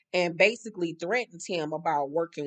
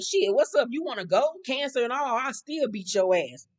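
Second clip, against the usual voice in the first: higher, louder fighting challenge; mock challenge; 6 semitones higher, 3 dB louder than usual. [name] challenges Vic to fight, mock confrontational. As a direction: throw down a dare to fight, pitched up.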